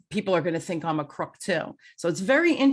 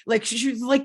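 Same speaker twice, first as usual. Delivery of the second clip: ordinary delivery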